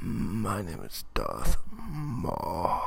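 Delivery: low, slow menacing Sith voice; a deep slow villain greeting; Darth Maul impression. YouTuber opening his review as Darth Maul, low and slow. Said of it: "Okay, that was weird".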